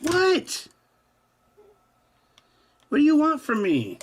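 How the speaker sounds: exasperated high pet voice; 1 high cat-talk. Real, not acted.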